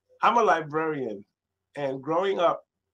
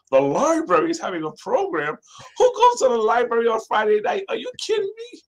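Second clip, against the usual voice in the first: incredulous, much higher and louder, cracking up high at the end; mocking disbelief; 10 semitones higher, 5 dB louder than usual. Teen speaker mocks Chucky's library plan, incredulous and loud. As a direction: scoff at the idea like a teen.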